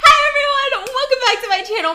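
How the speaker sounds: high, loud bubbly YouTuber hello; excited intro greeting. Real, not acted. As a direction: greet the viewers with big energy.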